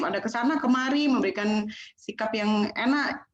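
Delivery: ordinary talk, nothing marked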